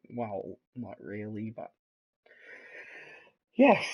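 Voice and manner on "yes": excited shout. Real, not acted. Sudden excited yes mid-commentary.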